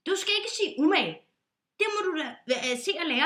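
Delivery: sharp scolding. Acted. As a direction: snap at him.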